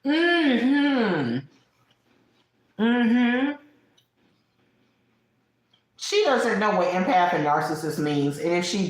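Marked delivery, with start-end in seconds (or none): 0.0-3.5 high, knowing hum of agreement; sassy mm-hmm. Real, not acted. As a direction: hum it like you told them so.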